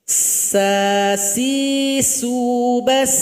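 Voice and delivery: "Sa, si, su, bas" sung up the scale, each s crisp and hissed; sin letter drill. Shown as a demonstration. Teacher demonstrating sin to students, chanted drill. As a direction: chant "Sa, si, su, bas" with clear sharp s sounds. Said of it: "Ujung lidah tempelkan ke dinding gigi".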